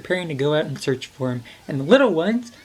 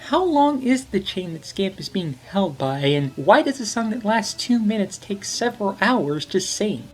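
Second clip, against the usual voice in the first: ordinary talk, nothing marked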